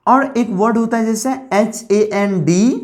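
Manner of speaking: ordinary delivery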